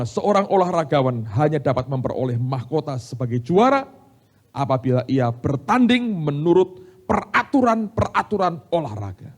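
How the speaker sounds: loud, emphatic proclamation, rising in pitch; forceful scripture reading. Acted. Preacher reading the next verse to the congregation, forceful proclamation. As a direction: declaim the verse, slowing toward the end.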